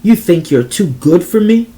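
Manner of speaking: loud challenge in broken English; broken English accent. A narrator's reading. Xander confronting Desiree, angry broken-English accent. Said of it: "he said in broken English".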